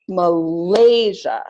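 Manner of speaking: "Malaysia" slow, with LAY long and loud; stress on second syllable. Shown as a demonstration. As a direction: clap out ma-LAY-sia for "Malaysia". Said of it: "Second syllable is longer and louder".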